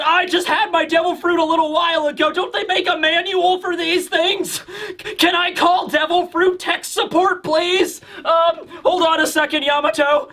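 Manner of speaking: high-pitched, flustered whining; flustered pleading. Acted. Overwhelmed Momonosuke asking for devil fruit tech support, flustered pleading. Acts flustered kid begging for help.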